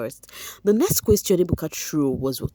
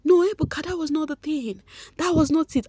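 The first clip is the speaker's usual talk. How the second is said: high, hoarse flustered denial; stuttering Chinwe; 11 semitones higher than usual. Retold or imitated. Chinwe stammering her denial to Ebuka, high and flustered. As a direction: mimic a stammering contestant caught out. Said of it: "Chinwe started stuttering".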